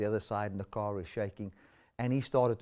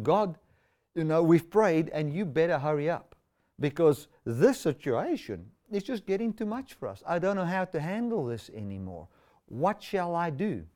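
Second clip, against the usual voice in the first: higher, louder plea to God; pleading; 4 semitones higher, 3 dB louder than usual. Acted. Believers telling God to hurry up, exasperated plea. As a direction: plead with raised pitch, at the end of your tether.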